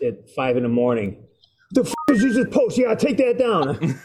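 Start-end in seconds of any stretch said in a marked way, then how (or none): 1.7-4.0 stern caller on the phone; imitating an angry caller. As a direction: bark it like an annoyed boss. Boss calling about a post.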